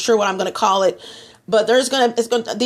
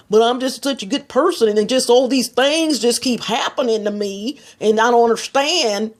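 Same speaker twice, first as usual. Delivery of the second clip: put-on Texas self-pity; mock Texas complaint. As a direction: whine it in a hammed-up Texas accent. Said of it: "I'm so glad I was born in Texas".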